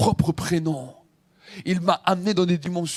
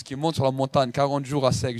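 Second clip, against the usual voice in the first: ordinary delivery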